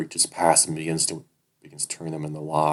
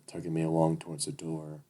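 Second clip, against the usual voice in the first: plain reading voice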